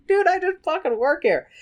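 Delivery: high-pitched mock American barkeep; American bartender. Bartender fending off drunk Raoul, high exaggerated protest. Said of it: "the bartender's like".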